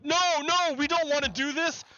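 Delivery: lightly hammed-up whiny no, no; mock Republicans. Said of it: "Republicans were like".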